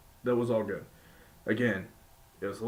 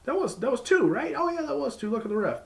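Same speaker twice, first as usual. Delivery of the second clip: much higher, hammed-up confused double take; surprised announcers; 13 semitones higher than usual. Announcers catching the early bell, mock high surprise. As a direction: squeak out baffled disbelief, then catch on. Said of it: "were saying".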